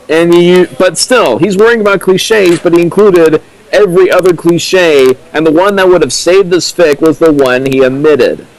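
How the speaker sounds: rising disbelief at the fic; exasperated rant. Real, not acted. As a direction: vent the frustration at the author. Exasperated rant about cliches.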